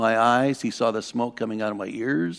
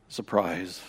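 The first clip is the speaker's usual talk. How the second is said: ordinary talk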